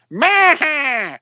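high, drawn-out nephew's catchphrase yell; imitated yell. Uncle mimics nephew who went around yelling it, mock yell. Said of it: "he'd go around yelling".

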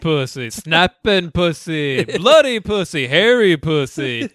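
hammed-up strip club barker; carnival barker. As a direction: sell it like a sideshow barker. Imitating a barker's sales pitch.

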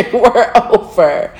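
slow, laughing we're over; amused, slow declaration that it's over. Real, not acted. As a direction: chuckle through it slowly. Laughing about ending it.

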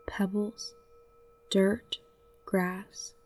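ordinary talk

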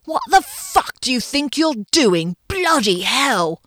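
high-pitched ranting English chef; hammed-up celebrity chef outrage; Gordon Ramsay impression. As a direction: tear into the cook in a bad English accent. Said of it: "do his Gordon Ramsay impression".

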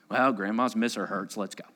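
ordinary talk

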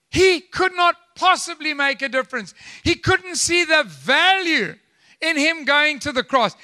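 high-pitched, driving sermon delivery; impassioned preaching. Real, not acted. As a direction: punch key words to the congregation. Preaches at full force.

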